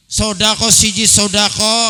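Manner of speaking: loud, high call-and-response lead; rallying preacher. Real, not acted. Preacher rallying Mangunjaya villagers to pray together, high loud rallying call. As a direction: belt the slogan out for the crowd to answer.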